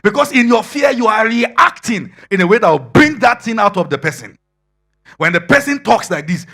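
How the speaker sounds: loud, high, driving sermon delivery; full-force preaching. Acted. Preacher warning the congregation against fear, in a loud, high, full-force voice. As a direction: hammer the warning about passing on fear.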